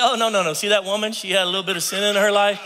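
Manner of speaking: Jesus cutting him off emphatically; emphatic correction. Acted. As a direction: set him straight with a punchline push. Jesus correcting him, louder higher.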